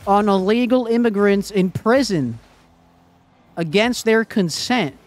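loud, high-pitched incredulous rant; mock conspiracy believer. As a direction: play a worked-up podcast-fed alarmist. Plays a ranting conspiracy believer.

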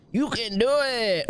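high, drawn-out cheer; hammed-up movie quote; movie catchphrase impression. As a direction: belt out the catchphrase in a high, slow put-on voice. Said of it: "Water boy".

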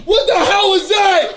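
high, laughing shout after a scare; startled yell. Real, not acted. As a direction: yell it in shock, half laughing. Yelling right after a jump scare.

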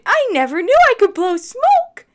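high, very loud thrilled cry; excited little dragon. Acted. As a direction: cry it out in pure delight. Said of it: "Dexter cried".

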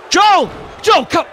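high, loud cries to the fighter; emotional shouting. Pretend. Commentator gets emotional and calls out to Joel, shouting. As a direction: yell the name, losing commentator composure. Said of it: "Sorry, I got emotional".

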